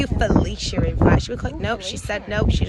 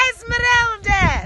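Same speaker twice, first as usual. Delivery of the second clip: put-on name called out in a Scottish accent, pitched up; 9 semitones higher than usual. Owner calls the chicken by name in a Scottish accent. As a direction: lilt the name like a Scot calling a pet. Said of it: "said that with a kind of Scottish accent".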